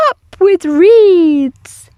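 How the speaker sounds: long, loud high hoot; drawn-out hooted cheer. A narrator's reading. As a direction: stretch it into a dinosaur hoot. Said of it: "And they hooted".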